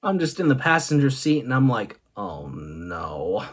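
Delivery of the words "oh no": deep, soft "Oh, no" groan; low embarrassed moan; low dismayed groan. Retold or imitated. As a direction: sink your voice into a groan of dismay. Dismayed reaction in passenger seat.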